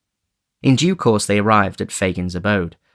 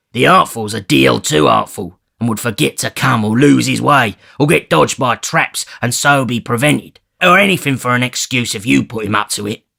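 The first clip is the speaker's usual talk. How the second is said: gruff Bill Sikes; 4 semitones higher, 5 dB louder than usual. Acted. Sikes refuses.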